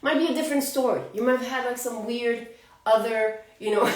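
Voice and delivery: ordinary talk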